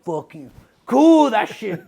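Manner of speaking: loud, slow, stretched out cheer; mock hyped yell. Boy on the bike cheering himself, loud and slow. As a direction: hype yourself up before the crash. Kid's reaction in the story.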